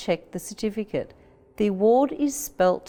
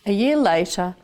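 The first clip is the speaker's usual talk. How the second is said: plain reading voice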